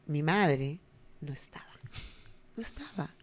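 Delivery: hushed, breathy whisper; dramatic whisper. Retold or imitated. Telling the cohost the mother vanished, in a whisper. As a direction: drop to a whisper for the reveal.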